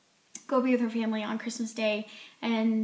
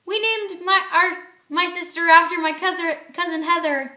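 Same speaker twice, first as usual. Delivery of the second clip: flustered excuse; 6 semitones higher, 4 dB louder than usual. Retold or imitated. Georgie flustered, making up an excuse.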